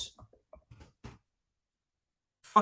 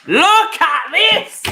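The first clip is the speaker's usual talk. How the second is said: high, loud, slow drawn-out yell; exasperated shout; 12 semitones higher, 13 dB louder, much slower than usual. Real, not acted. Fan yelling at his team on screen, loud and stretched out. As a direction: bellow it in disbelief at the screen.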